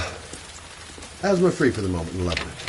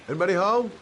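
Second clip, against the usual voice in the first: calling out. Acted. Knocking, calling into the house.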